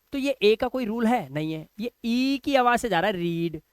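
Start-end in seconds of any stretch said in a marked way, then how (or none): none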